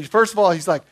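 ordinary talk, nothing marked

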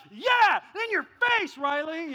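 high, loud taunting yell; triumphant gloating. Retold or imitated. Dad beating his son at Uno, gloating shout. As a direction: rub the win in, hammed-up.

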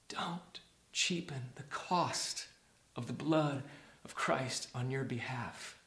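slow, soft, weighty warning; solemn hush. Real, not acted. Preacher warns against cheapening Christ's blood, solemn and hushed. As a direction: plead quietly and slowly.

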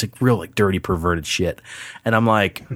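normal talking voice

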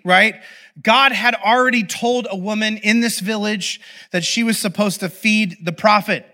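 ordinary delivery

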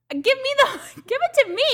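high, loud and laughing, begging for it; laughing mock plea. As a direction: beg for the gas through laughter.

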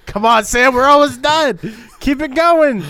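high, loud cheering on; excited urging. Real, not acted. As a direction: pump up the partner loudly. Cohost urging loudly.

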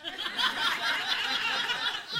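high, loud laugh bursting out; real burst of laughter. Real, not acted. Laughing at the wife's surprise prayer, loud burst.

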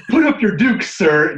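mock old-timey fight challenge, high and loud; old-timey challenger. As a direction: goad him like a theatrical old-time boxer. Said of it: "like, old-timey".

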